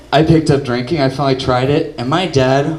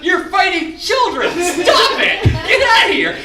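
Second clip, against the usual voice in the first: frantic yelling; slower than usual. Retold or imitated. Son stopping dad fighting children, high panicked yelling. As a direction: scream at your dad to stop. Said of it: "I had to run out and go".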